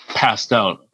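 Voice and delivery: plain reading voice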